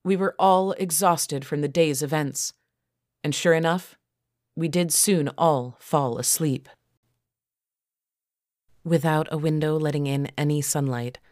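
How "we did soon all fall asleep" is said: ordinary delivery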